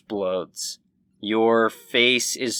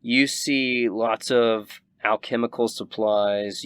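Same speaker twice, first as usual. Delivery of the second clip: usual speaking voice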